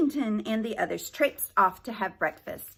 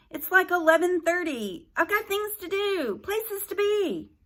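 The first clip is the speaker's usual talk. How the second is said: put-on whiny teen voice; 10 semitones higher, 4 dB louder than usual. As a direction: whine like a bored teen. Same teen continues.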